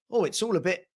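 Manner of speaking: ordinary talk, nothing marked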